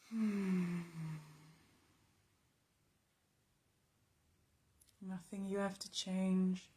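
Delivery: quiet, even meditation voice with long pauses; calm, unhurried, spacious delivery; guided meditation. Real, not acted. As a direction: guide them softly through noticing the body.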